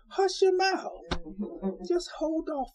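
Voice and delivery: preacher's high call to hush up; hushed shout. Real, not acted. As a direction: fling it out to the whole room. Preacher exhorts the congregation.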